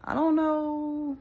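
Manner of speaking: high, slow, unsure mock brush-off; awkward brush-off. Polite non-believer backing away from a preacher, high and hesitant. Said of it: "I'd be like".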